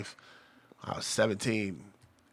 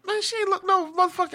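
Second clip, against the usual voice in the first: much higher, mock-incredulous protest; friend's disbelief; 4 dB louder than usual. Friend refusing to believe she was sixty-three, a much higher incredulous squeal. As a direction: squeal it like you can't believe it. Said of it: "It was like".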